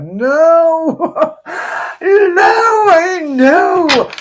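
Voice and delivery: very high, loud crying "no"; anguished wail. Real, not acted. To her viewers about her birthday deck, a loud high anguished wail. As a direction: wail it out like heartbreak. Wails over the broken card.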